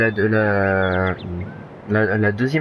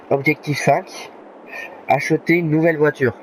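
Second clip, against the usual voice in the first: announcing; 6 semitones higher than usual. Real, not acted. Announcing the next objective.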